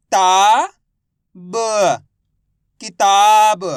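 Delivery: loud long ता, separate ब, then blended किताब; syllable-by-syllable reading. Shown as a demonstration. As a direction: sound out किताब slowly for a class to repeat. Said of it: "मेरे साथ साथ इनको repeat करना है". (ता, ब अलग-अलग, फिर किताब खींचकर ज़ोर से)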